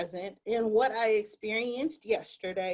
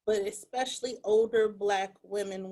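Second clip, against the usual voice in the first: ordinary delivery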